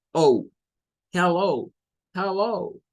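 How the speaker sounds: "hello" ending in an O-U glide; long O ending. Shown as a demonstration. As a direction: let the last vowel of "hello" slide from O to U. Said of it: "mezcla el O con la U".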